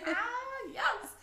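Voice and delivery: high, laughing squeal of delight; delighted outburst. Real, not acted. Delighted outburst while laughing together.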